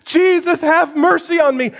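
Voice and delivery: high pleading call; desperate cry. Acted. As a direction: cry out blindly for mercy. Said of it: "He doesn't know where he is".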